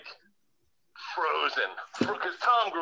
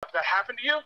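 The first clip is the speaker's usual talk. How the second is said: much higher, astonished retelling; disbelieving surprise; 8 semitones higher than usual. Retold or imitated. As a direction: react in shocked disbelief. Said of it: "And I started laughing".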